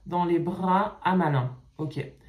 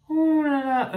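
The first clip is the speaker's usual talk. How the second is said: high, louder dismayed exclamation; exasperated oh là là; 8 semitones higher, 5 dB louder, slower than usual. Real, not acted. Reader dismayed by the fanfic, exasperated high exclamation. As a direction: throw up your hands at the text. Gives up on a badly written fanfic.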